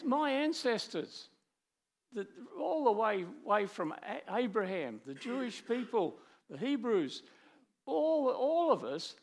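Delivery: a lightly voiced anguished psalmist; pleading psalmist. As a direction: press the case, voice climbing. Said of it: "he says to God".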